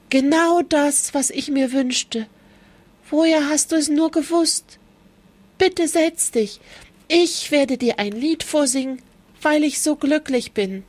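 a turtle overjoyed by the lettuce; delighted turtle. Acted.

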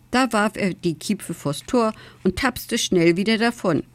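plain reading voice

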